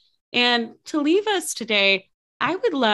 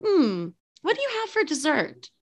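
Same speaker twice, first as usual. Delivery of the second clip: higher, musing customer voice; diner customer; 6 semitones higher than usual. Pretend. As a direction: play a customer pondering dessert.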